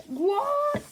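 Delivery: high, loud mock shriek of disbelief; shocked shriek. Narrator acting out a character's shock at a name, shrieked. Acted shocked reaction in retold story.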